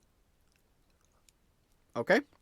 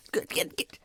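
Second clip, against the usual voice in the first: whispered shooing; 6 dB quieter than usual. Acted. Fisherman shooing player.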